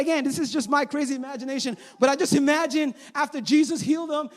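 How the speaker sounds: ordinary talk, nothing marked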